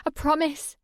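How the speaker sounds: high, desperate cry; plead, voice climbing high; panicked. Acted. Crying, pleading.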